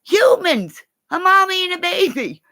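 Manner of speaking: very high, gushing exclamation; reenacted squeal at an adorable sight; excited squeal. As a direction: cry it out in hammed-up delight. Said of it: "I'm going".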